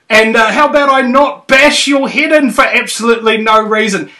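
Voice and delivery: high, hammed-up friendly bloke; matey bro voice. Host voicing an imagined friendly bloke to a stranger, high mock matey voice.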